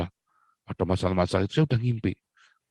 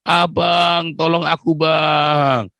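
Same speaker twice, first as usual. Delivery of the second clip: pleading call; 6 semitones higher, 5 dB louder, much slower than usual. Retold or imitated. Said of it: "saya teleponnya".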